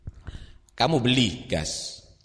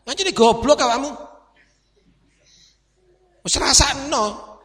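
scolding father; 7 semitones higher, 4 dB louder than usual. Retold or imitated. Voicing harsh scolding father.

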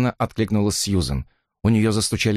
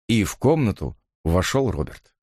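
usual speaking voice

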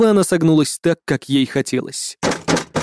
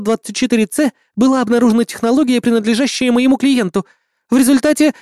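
officious gnome lawyer; 7 semitones higher than usual. A narrator's reading. Lawyer gnome reads charges.

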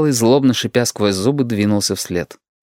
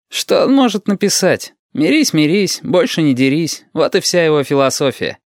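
Shut mocking; 7 semitones higher than usual. Shut mocks Dima.

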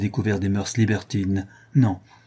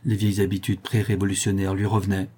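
plain reading voice

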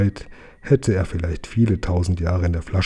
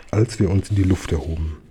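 usual speaking voice

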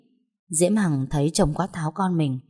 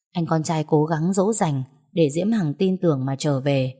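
plain reading voice

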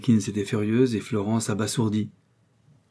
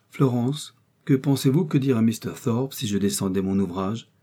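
ordinary delivery

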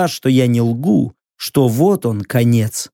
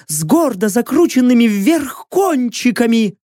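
high, firm warrior woman's voice; a proud captive bargaining; proud female warrior; 9 semitones higher than usual. Acted.

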